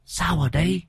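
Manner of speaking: put-on high, eerie voice of a ghost child through the medium; possessed medium speaking as the dead girl. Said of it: "phát ra âm thanh khe khé".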